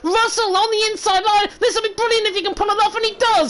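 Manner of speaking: high-pitched, breathless play-by-play climax; excited race commentary. Real, not acted. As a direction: call a stunning overtake as it happens. Live call of a daring overtake.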